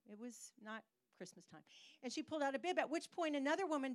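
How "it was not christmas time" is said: "It was not Christmas time" dropped much softer; quiet aside. Shown as a demonstration. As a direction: repeat it under your breath.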